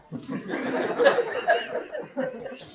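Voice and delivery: high breathy laugh; real laugh. Real, not acted. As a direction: she laughs at her own joke. Laughs after joke.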